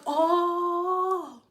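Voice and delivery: quiet, airy, sweet talk; a gentle, fading mock-polite voice; soft sweet hair voice. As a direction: coo it softly like a pushover. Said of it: "So they just".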